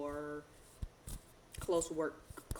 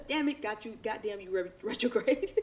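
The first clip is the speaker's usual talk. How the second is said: higher, louder, laughing through the stumble; laughing at a flub; 5 semitones higher, 6 dB louder than usual. Real, not acted. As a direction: crack up at tripping on the word.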